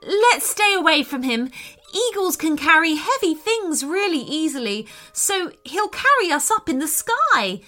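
high, worried character voice; mildly trembling, high-pitched fear. A narrator's reading. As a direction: warn them fearfully, pitched high. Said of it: "in a trembling voice".

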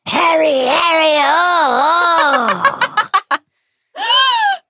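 mock screams of passion, very high and loud; hammed-up falsetto moaning cries; Hermione. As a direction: squeal it as a comic parody of ecstasy. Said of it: "Hermione's been screaming".

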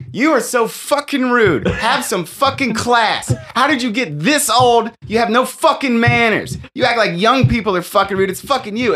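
hammed-up tirade at the rude old couple; retold furious rant. Comic telling off rude old hecklers, mock furious. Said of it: "I just fucking lose it".

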